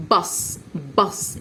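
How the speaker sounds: بص drawn out slowly and softly; slow soft model word. Shown as a demonstration. Teacher drawing out بص gently for pupils. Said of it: "الأه ساوند هو اللي في النص".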